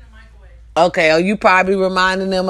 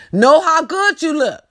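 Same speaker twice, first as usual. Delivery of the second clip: ordinary talk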